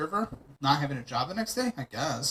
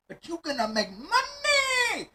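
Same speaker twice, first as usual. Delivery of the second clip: high-pitched, drawn-out cheer on "money"; excited hype; slower than usual. Real, not acted. Selling a stressful shift as a money-making opportunity, excited. Hyping the payoff of stressful work.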